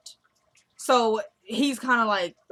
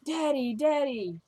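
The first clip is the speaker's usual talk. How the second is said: lightly voiced kids, higher pitched; a hint of excited children, raised pitch; kids calling Daddy; 5 semitones higher, slower than usual. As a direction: mimic children calling out to their father. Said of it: "the kids come out saying".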